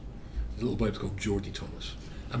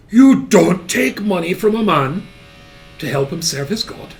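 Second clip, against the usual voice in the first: loud, slow, high-pitched rebuke; stern scolding; 7 semitones higher, 12 dB louder than usual. Retold or imitated. Strict mother teaching her son, loud stern scolding. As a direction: hammer out each word to a child.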